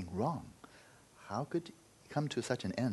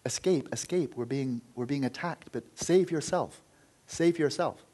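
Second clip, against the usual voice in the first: high, loud pleading cries to a son; desperate calling out; 6 semitones higher, 5 dB louder than usual. Retold or imitated. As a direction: call out to warn him, frantic and loud. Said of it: "his parents are calling out".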